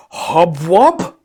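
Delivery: loud nonsense question; puzzled nonsense word; thin man asking in a strange tongue; gibberish question. A narrator's reading. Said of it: "he asked".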